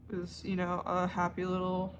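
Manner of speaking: ordinary delivery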